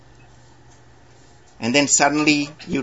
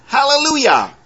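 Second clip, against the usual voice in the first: high, ringing preacher's voice; fervent pulpit delivery; preaching; 12 semitones higher, 4 dB louder than usual. Acted. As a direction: lift it up to the congregation. Preaching climax.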